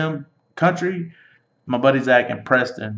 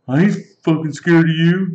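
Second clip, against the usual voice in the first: raised, louder mock defiance; mock drunken challenge; 5 semitones higher, 5 dB louder than usual. Friend retells drunk Country challenging him, mock defiant. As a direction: imitate a drunk squaring up for a fight.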